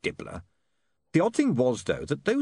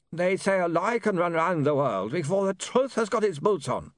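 higher-pitched smooth villain voice; pitched-up replayed speech; recorded villain; 9 semitones higher than usual. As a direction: play back the villain's speech through an imp.

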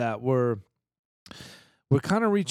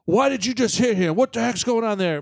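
raised, higher angry dad voice; dad yelling; 8 semitones higher, 3 dB louder than usual. Retold or imitated. Dad yelling back at the kids from the driver's seat, angry. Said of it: "My dad was like".

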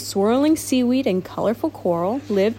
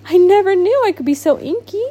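higher, louder, quick thrilled thought; delighted surprise; 6 semitones higher, 3 dB louder, faster than usual. Acted. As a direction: bubble over with joy at the ink. Thought Octopus.

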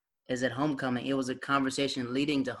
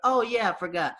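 mock casual; 9 semitones higher than usual. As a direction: play it cool and innocent. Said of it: "I was like".

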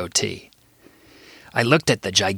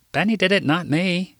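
indignant; 9 semitones higher than usual. A narrator's reading. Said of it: "he said indignantly".

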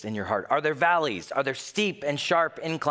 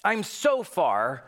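high, slow mock cry of being lost; a hint of a lost sinner; mock lost soul; 8 semitones higher, 3 dB louder, slower than usual. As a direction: whine it like someone far off track.

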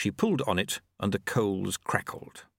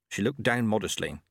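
ordinary delivery, nothing marked

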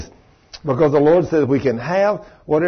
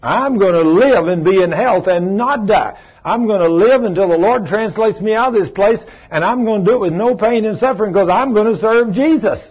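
bold declaration; 5 semitones higher, 3 dB louder than usual. Shown as a demonstration. Preacher tells the church to declare it, bold and loud. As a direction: proclaim it like a vow you mean.

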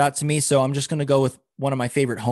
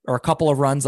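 usual speaking voice

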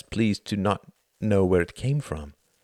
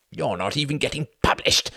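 hissed taunt; 7 semitones higher than usual. A narrator's reading. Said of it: "hissed Reginald".

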